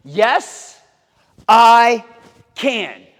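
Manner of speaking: put-on confident kids' class shout; stronger. Shown as a demonstration. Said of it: "let's do it a little stronger".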